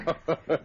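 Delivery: short mocking villain chuckle; villain's laugh. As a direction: laugh off the threat. Villain mocks the Mounties.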